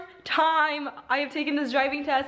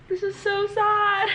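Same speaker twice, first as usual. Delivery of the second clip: high, drawn-out tearful lament; 7 semitones higher, 4 dB louder, slower than usual. Real, not acted. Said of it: "I'm literally crying right now".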